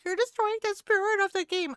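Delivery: squeaky mocking voice of an offended player; mocking whiny gamer. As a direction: imitate a prissy complainer. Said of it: "they're like".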